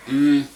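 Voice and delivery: big savoring hum of pleasure; loud delighted mm. Real, not acted. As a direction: hum like it's delicious. Tasting the potatoes.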